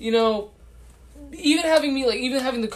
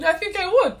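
mock eager; 11 semitones higher than usual. Shown as a demonstration. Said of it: "repeated line, different delivery".

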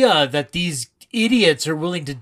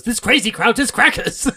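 showman's line; 7 semitones higher than usual. Retold or imitated. Director sings out his alliterative line to the crowd. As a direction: belt it like a camp stage star. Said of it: "Roger Debris goes".

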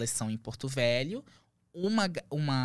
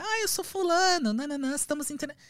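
mock caller voice, much higher and fast; imitated caller; 12 semitones higher than usual. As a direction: rattle off the producer's pitch in a high put-on voice. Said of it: "me liga".

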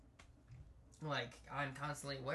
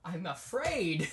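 loud, slow, mock-dramatic line; 5 semitones higher, 13 dB louder, slower than usual. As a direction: say it like a melodrama.

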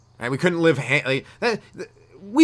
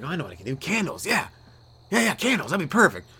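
dawning idea; 5 semitones higher than usual. Pretend. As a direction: sell yourself the candle plan. Cheap guy gets idea.

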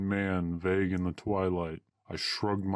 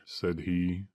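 ordinary delivery

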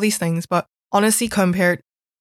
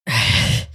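breathy laugh. Real, not acted. Laughs at school's hypocrisy.

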